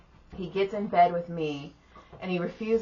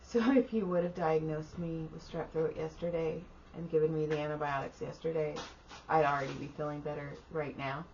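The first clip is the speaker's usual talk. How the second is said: soft, strained-throat whisper of a patient; hushed retold complaint; imitated sick whisper; 6 dB quieter than usual. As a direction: imitate someone who can barely talk. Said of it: "He was, like, whispering".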